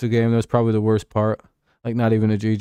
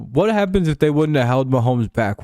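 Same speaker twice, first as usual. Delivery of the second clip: normal talking voice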